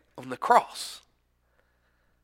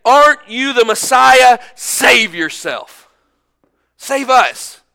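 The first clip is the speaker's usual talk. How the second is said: loud, higher mocking challenge; taunting; 7 semitones higher, 9 dB louder, slower than usual. Criminal on the cross taunting Jesus, loud and mocking. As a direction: jeer at him from the cross.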